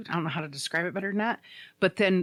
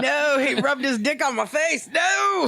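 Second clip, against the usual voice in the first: high, hammed-up cry of horror; mock horrified shout; 6 semitones higher than usual. Friend reacting to the accent story, mock horror. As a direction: shout it in comic outrage.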